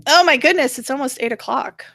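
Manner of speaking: ordinary delivery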